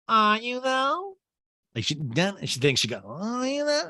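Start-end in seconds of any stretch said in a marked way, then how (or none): 0.1-1.2 sarcastic doubt. Retold or imitated. Erica's mocking question. 3.0-3.9 sarcastic doubt. Retold or imitated. Repeated sarcastic question.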